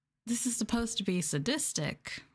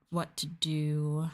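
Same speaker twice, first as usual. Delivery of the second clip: usual speaking voice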